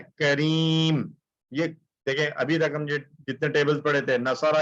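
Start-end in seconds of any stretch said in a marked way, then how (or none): 0.0-1.1 करीम stretched very slowly with a long ee; teacher shows the special word करीम very slowly; slow drawn-out demo. Shown as a demonstration. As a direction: draw out करीम slowly to show its pattern. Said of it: "जो special है".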